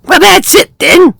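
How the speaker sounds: high bird voice; a defeated little bird, pitched high; high, resigned bird voice; lightly voiced little bird. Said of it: "said Oxpecker".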